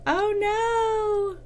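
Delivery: high yelp of alarm; alarmed when her live stream falters; sudden dismay. Real, not acted. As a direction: cry out as the connection drops. Stream cuts out mid-comment.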